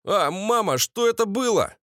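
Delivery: high, timid-wimp question; bespectacled husband's voice. A narrator's reading. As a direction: ask high as the meek son-in-law. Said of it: "Голос очкарика". (высокий голос очкарика)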